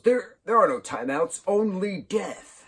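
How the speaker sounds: high, dramatic movie-villain quote; hammed-up big-brother menace; mock threat. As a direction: draw out the last words as a doom sentence. Acts out Roderick threatening Greg.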